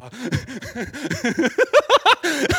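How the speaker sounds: high, loud burst of real laughter. Real, not acted. Preacher cracking up at his own story, loud laugh.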